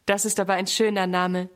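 plain reading voice

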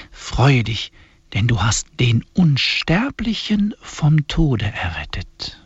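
solemn, measured liturgical reading; hymn recitation. Acted. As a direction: intone each line like a prayer. Reads the hymn aloud.

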